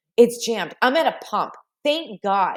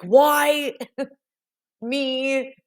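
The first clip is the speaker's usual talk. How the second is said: self-pitying lament; 5 semitones higher, 3 dB louder than usual. Retold or imitated. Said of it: "I was talking to myself".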